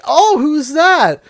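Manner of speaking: high, loud, slow mock curiosity; feigned ignorance, drawn out. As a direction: stretch it out, playing innocent. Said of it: "kind of drawing it just a little bit".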